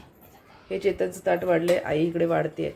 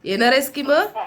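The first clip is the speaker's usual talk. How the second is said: coaxing call; 8 semitones higher, 4 dB louder than usual. Real, not acted. Coaxing the pet parrot out.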